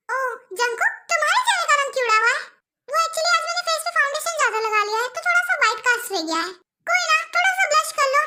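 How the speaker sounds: ordinary delivery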